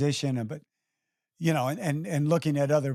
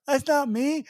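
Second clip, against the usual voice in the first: mock insecure friend; 10 semitones higher than usual. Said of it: "He goes".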